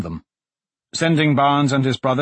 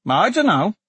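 put-on high, raised little boy's voice; small voice; 11 semitones higher, 4 dB louder than usual. A narrator's reading. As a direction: pipe up like a small boy reporting. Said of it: "a small voice said".

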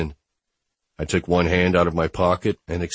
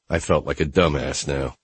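plain reading voice

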